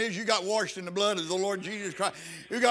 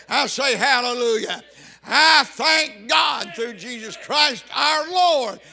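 ringing shout of thanks; preaching shout; 3 semitones higher, 6 dB louder, slower than usual. Real, not acted. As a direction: belt it out in triumph, stretching each word.

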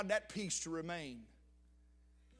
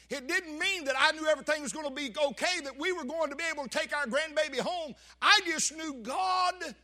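louder and higher, building to a shout on God; fervent declaration; 8 semitones higher, 8 dB louder than usual. Retold or imitated. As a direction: press it, then cry out the last words. Retells what he told preacher.

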